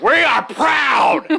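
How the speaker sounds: slow, high, loud proud shout; parade shout. Retold or imitated. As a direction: yell it like a marching protester.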